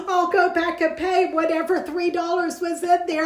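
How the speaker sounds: high-pitched mock mortification; embarrassed. Embarrassed customer promising to pay later, retold fluster. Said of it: "I was so embarrassed".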